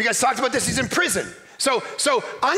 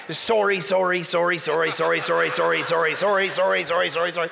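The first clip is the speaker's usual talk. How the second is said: mock Canadian apology; 7 semitones lower than usual. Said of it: "That's how you should preach as a Canadian".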